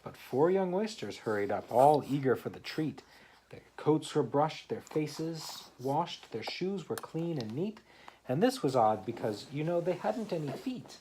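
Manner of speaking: lively read-aloud poem voice; story reading. Acted. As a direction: read the rhyme to a small child. Reads poem aloud to child.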